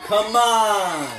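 yelling at the TV. Real, not acted. Fan yells Come on at the game, exasperated. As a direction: bellow it at the screen.

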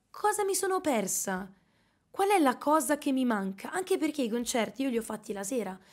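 ordinary talk

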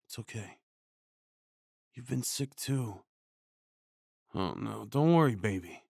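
ordinary delivery

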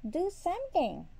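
high, loud demand from the wife; crying out. A narrator's reading. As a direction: cry out urgently for help. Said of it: "cried the woodsman's wife".

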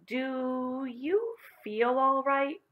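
very slow, high, careful question; slowly. A narrator's reading. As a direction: stretch each word out with wary concern. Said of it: "said Miss Plimsoll slowly".

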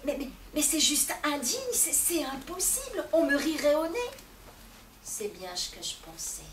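plain reading voice

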